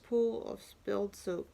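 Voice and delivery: ordinary delivery, nothing marked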